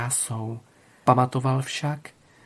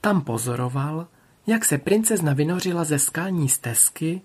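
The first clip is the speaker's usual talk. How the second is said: usual speaking voice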